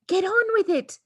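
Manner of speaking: very high, loud impatient urging; wife goading husband, very high and loud; impatient hiss. Acted. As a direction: shove him into action. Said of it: "hissed the mother".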